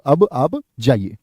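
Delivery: अब आप जाइए said slowly, each word spaced, teacher-style; slow model sentence for students; slow dictation. Shown as a demonstration. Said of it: "क्या बोले?".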